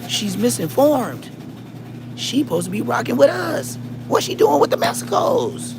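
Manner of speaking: very high squealing disbelief; mock outrage. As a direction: squeal it as scandalized friends. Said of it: "we be like".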